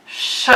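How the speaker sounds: sh said as a long hushed hiss; teaching the sh sound slowly. Shown as a demonstration. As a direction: blow out a steady sh. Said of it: "Can you hear that".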